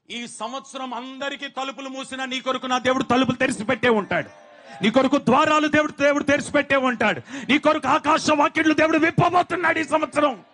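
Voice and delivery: loud, rising into a shout; full-force preaching. Acted. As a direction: proclaim the promise to the back row. Promising open doors, loud. (బిగ్గరగా అరిచే ప్రసంగ స్వరం)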